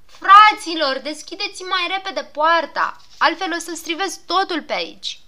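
loud frantic call to open the gate; shouting. Acted. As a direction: yell it out, mostly at the first word.

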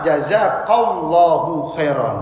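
jazakallahu khairan said slowly and deliberately; slow, careful jazakallahu khairan, like a model for the class; slow model phrase. Shown as a demonstration. Teacher showing what to say to one man, slow and clear. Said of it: "maka apa?".

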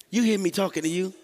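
mock parent telling off a child; scolding parent. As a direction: demand an answer like a fed-up mom.

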